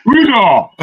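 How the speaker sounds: loud, high call of the name. Real, not acted. Host calling out to Uzo to speak, loud call. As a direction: shout across the room to get attention.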